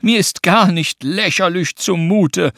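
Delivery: loud, high, slow woe; mock lament. A narrator's reading. Anton starts the game anew, loudly mock-wailing. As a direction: start the playful wail over, hammed-up.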